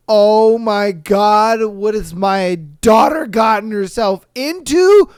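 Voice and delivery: loud, slow, stunned exclamation; hammed-up horrified father; mock horrified dad. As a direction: play the shocked father. Dad voiced in retold story.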